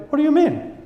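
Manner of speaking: a high, puzzled question; puzzled reply. Retold or imitated. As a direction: ask back, caught off guard.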